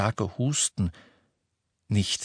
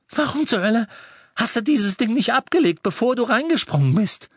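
much higher and louder yell; angry shout; 12 semitones higher, 5 dB louder than usual. A narrator's reading. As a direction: scold him at the top of your voice. Ron yells at Harry. (wütend schreiend)

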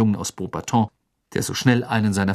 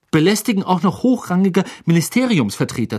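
indignant, higher-pitched hiss; 8 semitones higher, 4 dB louder than usual. A narrator's reading. Percy complains about his brothers' behaviour, hissing. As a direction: hiss it with affronted disapproval. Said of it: "zischte Percy".

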